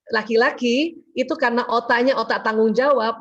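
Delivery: ordinary talk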